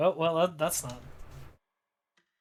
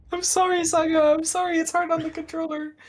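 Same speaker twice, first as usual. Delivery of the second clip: high-pitched apology; 9 semitones higher, 4 dB louder than usual. Pretend. Mock apology to game character.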